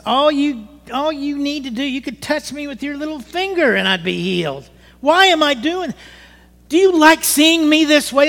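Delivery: high, loud angry yell at God; retold yelling at God. Retelling his yell at God in pain, hurt angry shout. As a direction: cry out in anger, pleading. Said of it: "And I was mad".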